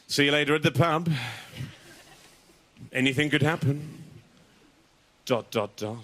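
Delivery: texting a lady, trying to sound suave. Retold or imitated. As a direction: try to sound suave and mysterious. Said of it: "trying to sound suave".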